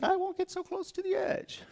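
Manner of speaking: tiny, very high squeaky mimic voice; mock frightened public. People reacting to a bear warning sign, squeaky mock voice. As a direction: squeak it like a comically cowed onlooker.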